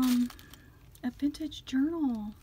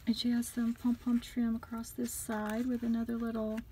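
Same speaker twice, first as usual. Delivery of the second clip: normal talking voice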